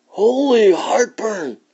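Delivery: high, loud, slow alarmed holler. Acted. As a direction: cry it out in startled alarm. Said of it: "hollered Scipito".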